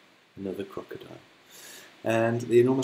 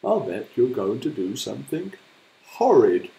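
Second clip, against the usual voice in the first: builds to a much louder horrid; disapproving hippo; 8 dB louder than usual. Acted. Hippo accusing the crocodile, disgusted loud voice. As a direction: scold the crocodile with rising disgust.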